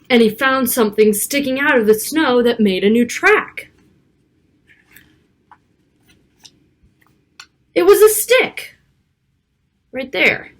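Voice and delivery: lively picture-book read-aloud; reading to children. Acted. Narrator reading a snowy storybook to young children, lively. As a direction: read it like storytime for little kids.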